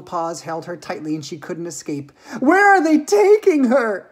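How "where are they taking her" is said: high, loud, drawn-out shriek. Acted. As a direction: shriek it as an alarmed mother. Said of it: "shrieked Missus Salt".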